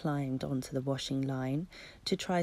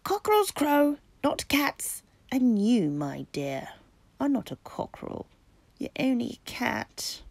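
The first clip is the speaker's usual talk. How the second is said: pompous cockerel; 6 semitones higher, 8 dB louder than usual. Acted. Cockerel lectures the kitten.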